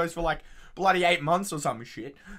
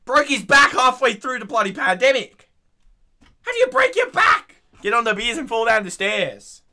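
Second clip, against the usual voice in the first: loud, high incredulous shout; exasperated rant; 5 semitones higher, 10 dB louder than usual. Real, not acted. Ranting to mates about the premier breaking his back, loud rant. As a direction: rant it loudly, voice jumping up on the question. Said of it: "That's enough ranting".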